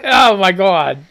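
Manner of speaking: ordinary talk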